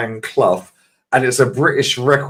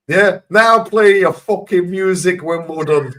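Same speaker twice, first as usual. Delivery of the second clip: slow, higher mock Clough accent, triumphant; Brian Clough accent; 7 semitones higher, 5 dB louder, slower than usual. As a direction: drawl it out as Clough to Wimbledon, higher. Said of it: "nails his fucking accent".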